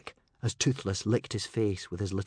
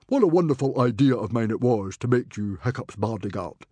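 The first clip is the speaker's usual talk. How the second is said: roaring proud chief, much louder; hearty Viking chief; 5 dB louder than usual. A narrator's reading. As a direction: boast like a proud rowdy chief. Stoic's voice again.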